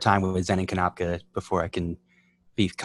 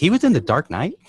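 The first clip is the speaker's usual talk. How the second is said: retold disbelief; 8 semitones higher, 6 dB louder than usual. Stunned reaction retold.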